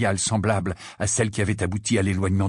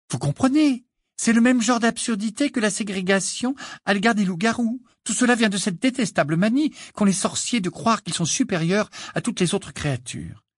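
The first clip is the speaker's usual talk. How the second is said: put-on higher, earnest girl's voice; voix d'Hermione; 10 semitones higher than usual. A narrator's reading. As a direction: lecture them earnestly, high-pitched. Hermione voix de personnage. (jeune fille indignée, voix aiguë)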